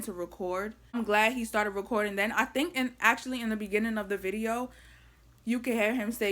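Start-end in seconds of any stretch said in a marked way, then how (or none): none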